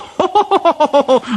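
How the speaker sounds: high, rapid hooting laughter; high staccato jeer; mocking laugh. A narrator's reading. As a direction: laugh gleefully at a rival's trouble. James mocks stuck Gordon.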